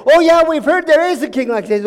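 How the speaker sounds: loud, high-pitched excited assurance; eager insistence. Acted. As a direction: burst out with eager certainty. Advisors insist eagerly to the king.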